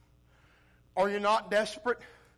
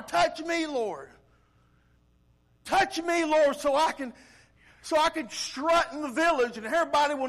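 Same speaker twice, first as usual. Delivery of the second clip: high, loud mock begging; showy petitioner; 6 semitones higher, 4 dB louder than usual. Villager begging the Lord for a new house, showy plea. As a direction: play up a self-seeking plea.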